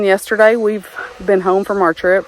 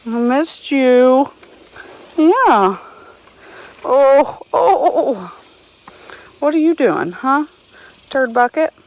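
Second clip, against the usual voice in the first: pet talk; 6 semitones higher than usual. Real, not acted. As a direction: tease the donkeys fondly. Cooing and teasing the donkeys.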